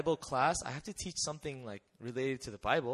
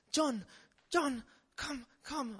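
put-on high urgent call of a child; urgent kid calling; 10 semitones higher, slower than usual. A kid runs in calling the teacher, high and urgent. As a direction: call him out like a frightened kid.

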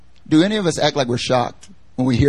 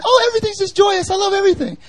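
much higher, chirpy mock voice of cheerful Kathleen; overly happy Kathleen; faster than usual. Preacher mimicking a relentlessly cheerful friend, sing-song high. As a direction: squeal it like someone too happy. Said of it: "so happy".

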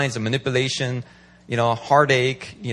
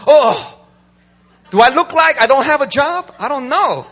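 mock offended; 9 semitones higher, 6 dB louder than usual. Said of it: "they get offended".

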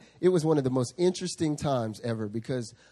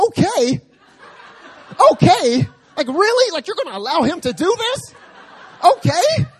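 much higher, much louder, laughing outburst; freaking out; 13 dB louder than usual. Retold or imitated. Zach flipping out at the pastor, loud high outburst. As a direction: lose it in shrill comic disbelief. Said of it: "Zach flips out".

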